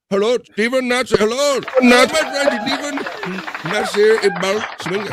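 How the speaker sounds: sing-song over-the-top hello; mock greeting voice. Greeting viewer Steven Nash in a high, hammed-up voice.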